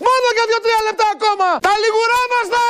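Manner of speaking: high-pitched hard-sell shout. Real, not acted. TV seller rushing viewers to grab the masterpiece, shouting. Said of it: "μη φωνάζετε".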